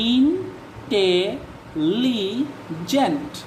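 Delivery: Intelligent in four very slow, separate syllables. Shown as a demonstration. Teacher showing how many breaks the word has, intelligent syllable by syllable. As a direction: break intelligent apart, pausing after every syllable. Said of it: "অনেকগুলো ভাঙ্গা দিতেছেন".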